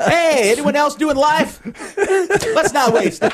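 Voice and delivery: high, slow hammed-up cheer; drawn-out, laughing chipper inmate; chipper prisoner. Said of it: "He's the Chipper Prisoner".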